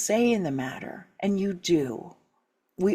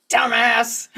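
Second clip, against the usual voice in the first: louder, higher shout of "Dumbass!"; mock driver's shout; 4 semitones higher, 5 dB louder than usual. Shown as a demonstration. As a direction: yell it at the car that cut you off. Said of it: "what's the first thing you say".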